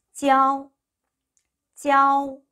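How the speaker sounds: 찌아오 held high and level; teacher's sustained high 찌아오; model pronunciation. Shown as a demonstration. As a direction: glide 찌아오 together on one even high note. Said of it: "라고 읽으시면 돼요".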